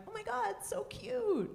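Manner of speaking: put-on high, cooing gush over the baby; mock gushing stranger. Strangers cooing at the new baby, high and gushing. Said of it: "People coming up to me like".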